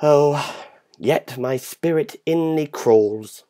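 ordinary delivery, nothing marked

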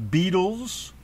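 ordinary talk, nothing marked

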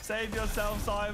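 panicking gamer. Real, not acted. Ambushed right after landing.

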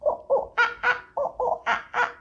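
very high ooh ooh ah ah; monkey calls. Acted. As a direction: chatter like a monkey. Monkey noises for children.

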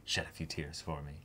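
low, hushed mock-tender question; hushed, put-on coy prodding; quiet teasing. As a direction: tease softly, dropping low and quiet. Acts Gojo teasing about tears.

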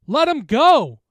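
high, very loud scream; screamed plea. Acted. Jen screaming at the bully beating her friend. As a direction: scream the order at the attacker. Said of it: "I heard Jen scream".

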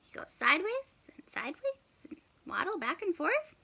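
fast whispered aside. A narrator's reading. Reader explaining the waddle to listeners, fast whisper. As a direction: whisper it quickly to the kids.

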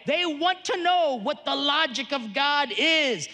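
slow, emphatic sermon voice; building preaching. Acted. Preacher driving his point home to parents. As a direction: preach it out, word by word.

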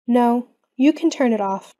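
ordinary talk

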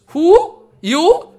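loud high teasing challenge; mock challenge. Babaji loudly teasing Bimala Prasad at the latrine door. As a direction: call him out playfully.

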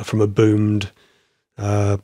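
normal talking voice

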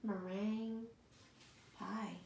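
ordinary delivery, nothing marked

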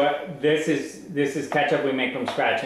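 ordinary talk

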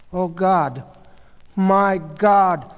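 horrified. A narrator's reading. Goldie recognizing who is on the stretcher, horrified. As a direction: gasp out a shocked prayer. Goldie realizes it is her husband.

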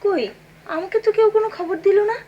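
high, loud indignant complaint. Acted. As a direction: scold them for keeping you uninformed. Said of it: "চেঁচামেচি আমার ভালো লাগে না".

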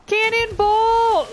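drawn-out high shout, slow; cannonball yell. Acted. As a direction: yell it as you leap. Jumping into icy water.

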